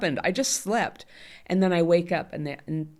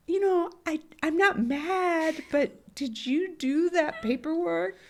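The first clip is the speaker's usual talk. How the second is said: much higher, slow, sugary fake-nice voice; fake pleasant; 13 semitones higher, slower than usual. Imitating herself faking niceness to her husband over paperwork. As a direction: pretend to be sweet while nagging. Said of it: "pretend that I'm being pleasant".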